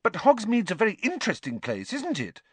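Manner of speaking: put-on eager girl pressing her point. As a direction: push on keenly, hurrying. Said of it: "pressed on eagerly".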